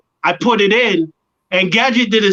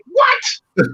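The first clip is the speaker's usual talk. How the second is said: high-pitched mock disbelief; crowd's shocked reaction; 11 semitones higher than usual. Everybody reacting to his line, shocked. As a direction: imitate a stunned crowd, pitch jumping way up.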